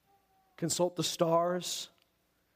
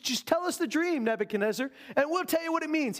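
pleading wise men; 9 semitones higher than usual. Retold or imitated. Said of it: "You just".

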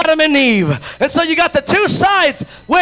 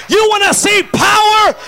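preaching at full force; 5 semitones higher than usual. Real, not acted. Pastor to the congregation, preaching at full force. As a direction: lift the room with it.

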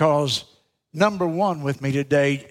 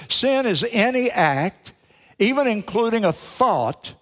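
fervent preaching; 5 semitones higher than usual. Acted. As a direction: drive the point home to the congregation. Preacher defines sin emphatically.

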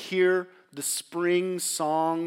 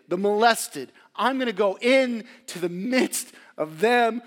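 rising, louder proclamation building to a cry; impassioned vow; 5 semitones higher, 7 dB louder than usual. Acted. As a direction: preach it like a fervent promise. Jesus's vow voiced by preacher.

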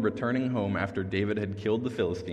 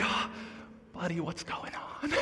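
gentle; 8 semitones higher, 7 dB quieter than usual. Retold or imitated. Comforting the crying boy.

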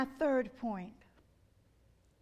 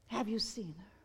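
hushed question; 8 semitones lower, 10 dB quieter than usual. Real, not acted. Preacher quietly asking the congregation, hushed. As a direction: lower and softer, drawn in close.

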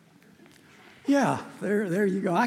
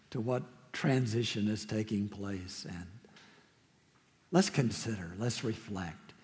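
soft, lower, calm invitation; gentle reflective; 4 semitones lower, 4 dB quieter than usual. Real, not acted. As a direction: draw them into quiet reflection. Pastor invites quiet reflection.

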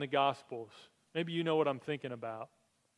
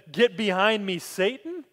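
louder, sharper rebuke; stern rebuke; 3 semitones higher, 6 dB louder than usual. Retold or imitated. Jesus rebuking Peter, louder and stern. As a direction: hit Satan hard as a reproof. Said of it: "he looks at me and he says".

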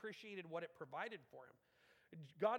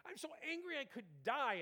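higher, fast, huffy retort; indignant Jonah; 9 semitones higher than usual. Acted. Jonah answering God, fast and indignant. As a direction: snap back like a sulky prophet. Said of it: "And Jonah's like".

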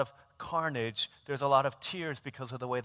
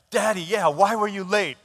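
louder, higher imitation of an indignant small boy; mock cross-examination by a child; grilling; 4 semitones higher, 7 dB louder than usual. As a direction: demand an answer from dad. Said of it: "state prosecutor is, like, grilling me".

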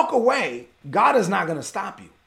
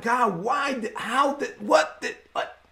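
halting, softer pleading with God; broken-off, bewildered questions, lightly acted crying; mock tearful plea; 3 semitones higher, 4 dB quieter, slower than usual. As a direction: act out being lost for words in tears. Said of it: "You're there crying and like".

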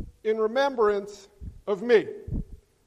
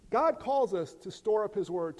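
usual speaking voice

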